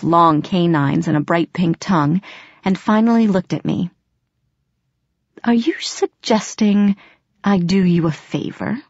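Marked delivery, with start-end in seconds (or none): 5.4-8.8 haughty cat. Acted. Grimalkin's slow haughty voice.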